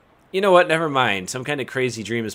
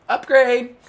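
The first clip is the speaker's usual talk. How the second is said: much higher, loud upgrade cry; excited shout; 13 semitones higher, 6 dB louder than usual. Acted. Player celebrating the hat upgrade, excited. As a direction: cheer like a level-up jingle.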